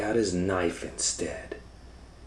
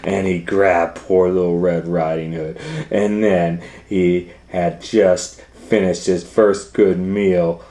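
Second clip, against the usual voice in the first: dramatic story narration; 3 semitones higher, 15 dB louder than usual. A narrator's reading. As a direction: drive the action home.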